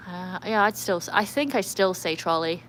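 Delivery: ordinary talk, nothing marked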